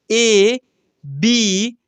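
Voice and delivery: drawn-out letters read for the class; teacher reading small letters aloud; slow letter reading. Shown as a demonstration. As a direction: read the letters slowly, one at a time. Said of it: "देखिए, क्या लिखा हुआ है".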